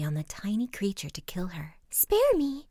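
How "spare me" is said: high, pleading little mouse voice; squeaky-high begging. A narrator's reading. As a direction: plead for mercy as a tiny mouse. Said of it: "begged the poor mouse".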